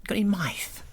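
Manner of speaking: high, whispered snake voice; hissing serpent whisper. Pretend. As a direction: hiss it like a hungry serpent. Reptile voice joke continued.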